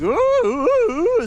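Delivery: high-pitched hooting; imitated ape calls. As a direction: hoot like a mating ape. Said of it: "They go like".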